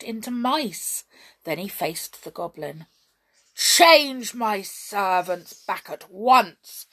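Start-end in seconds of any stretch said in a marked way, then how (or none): 3.5-6.9 very slow, louder commanding order; biggest voice. Acted. Hop orders the goblin to undo the spell, in his biggest voice. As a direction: draw yourself up and command. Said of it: "commanded in his biggest voice".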